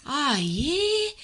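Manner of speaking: slow, lazy mock Kiwi accent. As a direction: drawl it out like a laid-back New Zealander. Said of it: "let me do my Kiwi accent".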